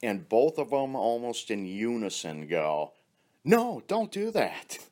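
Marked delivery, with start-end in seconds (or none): 3.4-4.8 high mock protest. Both women protest together.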